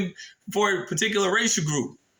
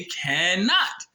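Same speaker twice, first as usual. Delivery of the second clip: CANNOT drawn out slowly; slow, insistent CANNOT with both syllables weighted; strict emphasis. Shown as a demonstration. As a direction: lean hard on CANNOT, stretching it. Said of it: "the strict emphasis of the word".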